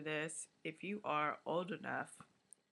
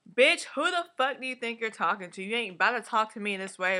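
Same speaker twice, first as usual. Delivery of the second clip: loud, fast, raised-pitch telling-off; going-off demo; 5 semitones higher, 7 dB louder, faster than usual. Shown as a demonstration. Showing Asha how to go off on Lionel, loud angry demo. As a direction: rattle off a forceful confrontation. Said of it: "she's trying to go off on her".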